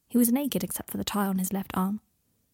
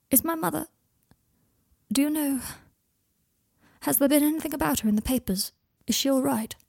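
higher, hesitant worried questions; falter, then ask with mild worry; a touch of anxiety, pitched up; anxious asking; 6 semitones higher than usual. A narrator's reading. Son anxiously asks after his mother.

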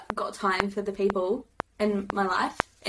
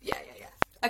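whispered aside; 7 dB quieter, slower than usual. Real, not acted. Quick aside while renaming podcast.

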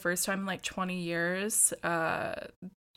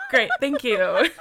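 loud words burst out through laughter; laughing shout; 10 dB louder than usual. Real, not acted. Retelling dad's backhanded praise, loud laughing shout. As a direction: shout it while cracking up. Punchline of dad story.

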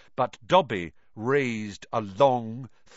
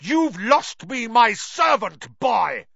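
furious; 9 semitones higher, 7 dB louder, slower than usual. Acted. Malfoy lunges at Harry.

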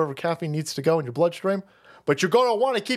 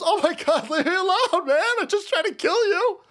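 mock driver; 10 semitones higher than usual. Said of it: "And he goes".

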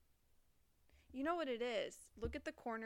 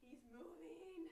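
high, slow, soft scared voice; 7 semitones higher, 9 dB quieter, slower than usual. Real, not acted. Watching spider.

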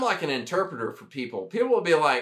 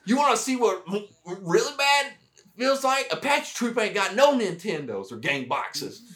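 mock sergeant major, much higher, laughing by the end; 6 semitones higher than usual. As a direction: ham up the old Southern sergeant. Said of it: "he was like".

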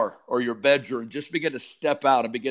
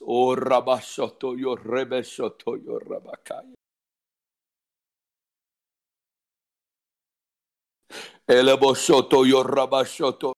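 rhythmic flowing glossolalia, fading at phrase ends; praying in tongues. Real, not acted. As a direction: let the syllables pour out in a chant.

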